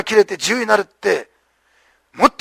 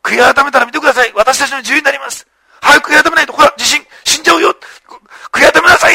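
building from a hushed opening to a high, loud urgent plea; preaching to prisoners; 5 semitones higher, 4 dB louder than usual. Retold or imitated. Paul urging the prisoners to repent, rising to a near-cry. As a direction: preach to the prisoners as if their lives hang on it. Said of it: "囚人たちの真ん中で説教するんですね".

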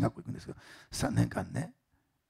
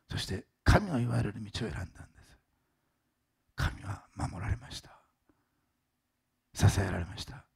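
solemn preaching; 4 semitones lower than usual. Acted. Pastor closing his sermon to the congregation, solemn.

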